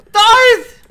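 yelling a name across the room; shouting. Real, not acted. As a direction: shout Darth's name loud and high. Calling out to dead teammate.

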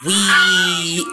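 loud high startled cry; scream. Real, not acted. Kid screaming at a game scare. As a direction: yelp out in alarm. Sudden scream while playing.

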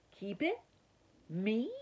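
the mouse can't believe his luck; surprised. Acted. Mouse asked to keep the motorcycle, surprised delight.

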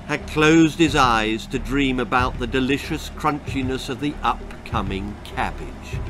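ordinary delivery

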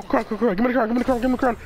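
normal talking voice

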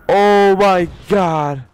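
loud, high, drawn-out panic; alarmed cry. Real, not acted. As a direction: wail it slowly in alarm. Tense boss fight, scared.

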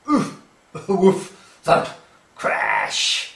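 wrestling noises. Acted. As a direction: act out the rough play.

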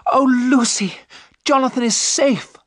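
high, loud cry of relief; joyful cry. A narrator's reading. Mina tells Lucy that Jonathan is safe, crying out. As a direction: burst out with the good news, high and loud. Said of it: "Mina cried".

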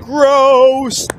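loud high gross; disgusted shout. Acted. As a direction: yell it in disgust. Disgusted at coffee.

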